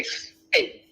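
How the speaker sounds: "Say." breathed without voice; mock the word as a hushed aside; whispered say. Lecturer whispering the word to ridicule it, breathy. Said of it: "They say".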